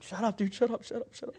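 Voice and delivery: high, giggly pleading shut up; fighting off the giggles while hushing a friend; laughing shush. Retold or imitated. Actor hushing laughing co-star during take, high and giggly.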